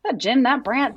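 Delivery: lightly voiced gee-whiz fifties kid; a hint of an old-timey wholesome kid; wholesome 1950s kid. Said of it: "conversations apparently happen in happy days in nineteen fifty".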